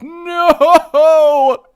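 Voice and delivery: high, loud anguished no; outraged scream. Real, not acted. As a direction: scream it out in despair. Discovers item missing, outraged.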